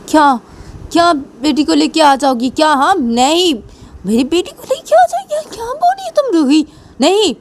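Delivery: high, incredulous voice; can't believe what she's hearing; shocked disbelief. Pretend. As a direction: Piyu reacting to Ruhi in high disbelief. Piyu shocked at Ruhi's answer.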